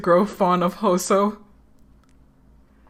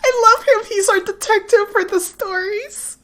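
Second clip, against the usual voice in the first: laughing; 4 dB louder than usual. Real, not acted. Gushing between laughs.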